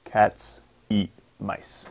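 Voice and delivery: slow, each stressed word hit on the beat; slow stressed rhythm. Shown as a demonstration. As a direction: tap out the beats slowly, Cats eat mice. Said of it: "How many stressed syllables are there?".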